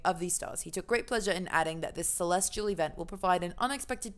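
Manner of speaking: brisk, hurried article read. Pretend. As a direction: hurry through it in mock British. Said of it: "let's read this faster".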